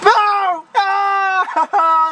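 high, loud frightened scream; terrified scream. Real, not acted. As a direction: scream as it lunges. Ghost lunges at player.

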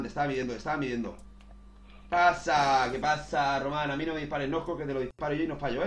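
ordinary talk

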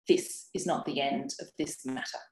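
low threat. A narrator's reading. Neighbour's parting threat.

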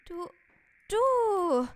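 long, high, loud cry; drawn-out high shout; acted furious shout. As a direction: yell it accusingly, stretching the vowel. Figure furiously accuses the other.

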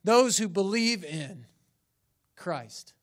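"those who believe in" stretched out firmly, "Christ" set apart; emphatic stress. Shown as a demonstration. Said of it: "The emphasis is on".

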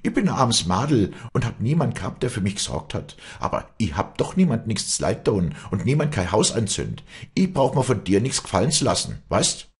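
high, loud self-defence; heated, high-pitched protest; indignant shouting. A narrator's reading. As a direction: cry out in hurt indignation. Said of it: "schrie das Mädchen".

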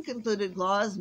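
usual speaking voice

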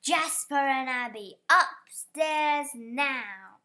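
roar. A narrator's reading. Manager roaring at Jasper and Abby, furious. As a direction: bellow the order, drawing it out. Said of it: "The manager roared".

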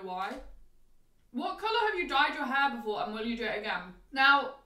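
chewing while talking; mouth full of food. Real, not acted. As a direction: hand over mouth. Seen 0: Fork at mouth at -1.0; hand covers mouth 3.0-3.8.

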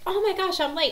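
high, loud mock panic; sudden high-pitched alarmed outburst, acted; freaking out. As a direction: imitate a child panicking about being late. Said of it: "she starts freaking out".

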